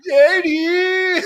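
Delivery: high, loud, drawn-out hype; loud stretched cheer; excited cheer. Real, not acted. Cheering Eddie on.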